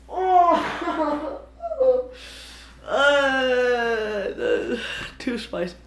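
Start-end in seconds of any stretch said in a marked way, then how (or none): 0.1-4.8 pained groan. Real, not acted. Burning mouth after soy sauce.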